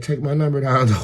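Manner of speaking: put-on high, loud child voice; imitating pushy kid. Little girl giving buyer her number, imitated pushy loud voice. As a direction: insist loudly as a bossy little kid.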